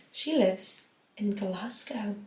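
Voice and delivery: slow, soft attempt at Scottish; badly faked Glasgow Scottish; bad Scottish accent. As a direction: try a Scottish accent and miss. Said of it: "I can't do a Scottish accent".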